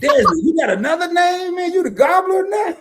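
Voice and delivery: high, loud teasing shout; real excited shout at a friend's nickname. Real, not acted. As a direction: call out the new name in delighted disbelief. Surprised by a new nickname.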